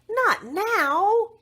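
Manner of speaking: high, loud, startled cry; startled protest. Acted. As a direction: blurt it out in alarm. Princess refuses monster alarm.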